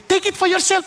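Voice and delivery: high, eager acted offer. Man offers his property to King David, eager and high.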